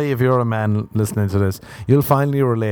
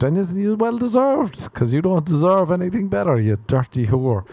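mock scolding; 8 semitones higher than usual.

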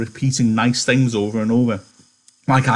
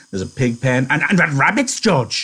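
put-on higher, stammering childlike man; eager Lenny; 4 semitones higher, 3 dB louder than usual. Lenny begging George for the rabbits, eager and stammering. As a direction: blurt it out like an overexcited big kid.

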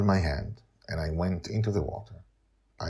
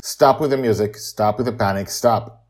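louder, insistent commands; firm scolding; 5 semitones higher, 10 dB louder than usual. Acted. Madeline ordering Amali to stop panicking, loud and firm. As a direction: make her stop, firmly.

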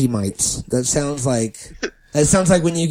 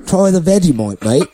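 serious Australian; 3 semitones higher than usual. Retold or imitated. Retelling the Australian turning serious over Vegemite, mock-grave Australian accent. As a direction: imitate an Australian insisting in earnest. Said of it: "And then he got serious".

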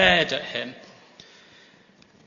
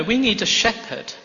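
normal talking voice

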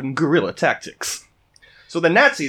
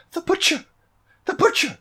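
high-pitched accuser, not shouted; imitated high female cry, held back in volume; accusing young woman; 13 semitones higher, 3 dB quieter than usual. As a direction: point him out in a high, horrified-witness voice. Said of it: "a young woman who points at him and says".

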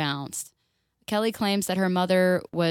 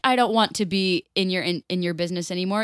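usual speaking voice

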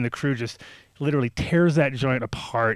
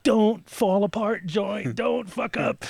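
high, drawn-out mock plea; anxious pleading; 9 semitones higher, much slower than usual. Storyteller begging the joint to hold together, anxious high pleading. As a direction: beg it under pressure, pitched up.

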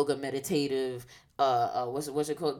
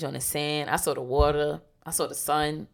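ordinary delivery

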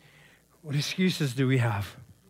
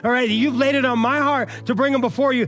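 high, loud exhortation; urgent preacher's call, high and loud; full-force preaching; 9 semitones higher, 6 dB louder than usual. Acted. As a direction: preach it hard to the youth.

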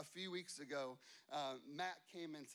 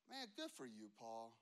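soft, low, wry congratulation; a touch of sarcasm, spoken quietly; dry sarcasm; 8 semitones lower, 8 dB quieter than usual. Retold or imitated. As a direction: needle Paul with mock praise. Sarcastic aside to Paul.

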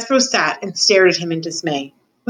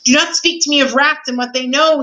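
higher-pitched, indignant royal shout; storyteller's shout; 6 semitones higher, 3 dB louder than usual. A narrator's reading. King refusing to hear of rats, shouting. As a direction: raise the voice as an outraged king. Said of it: "he shouted".

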